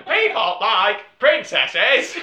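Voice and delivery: slow, laughing mock decree; laughing mock pitch. Podcaster mocks the Netflix algorithm, laughing slowly. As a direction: announce it slowly while cracking up.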